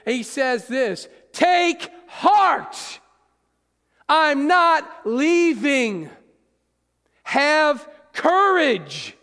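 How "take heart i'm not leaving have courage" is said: high, loud and slow, full of force; forceful shout. Acted. Jesus shouting to people in the storm, with force. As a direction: roar it out like a promise that holds. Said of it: "He is saying it with force".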